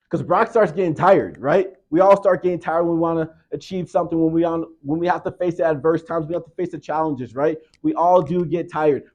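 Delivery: loud, high preaching. Acted. Preacher driving home the lesson to his youth group. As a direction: preach it with full force.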